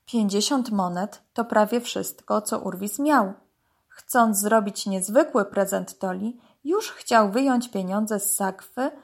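plain reading voice

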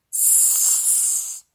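long, sharp sibilant hiss; angry snake hiss. A narrator's reading. Angered python answers the dog, hissing. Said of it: "zasyczał rozzłoszczony pyton".

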